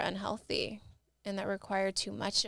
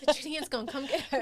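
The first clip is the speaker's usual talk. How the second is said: mock scared joking; 5 semitones higher, 5 dB louder, much faster than usual.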